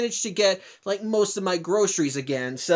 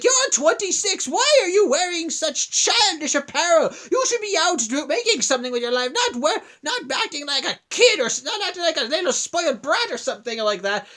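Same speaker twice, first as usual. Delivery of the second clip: higher pitched mock scolding of a grown man; mock old lady yell; 5 semitones higher than usual. Old lady yelling at a twenty-six year old in a Mario shirt, mock angry. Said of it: "starts yelling at me like".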